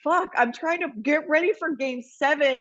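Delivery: high-pitched mock disbelief; imagined bafflement. Player trying to get ready for game seven, mock baffled.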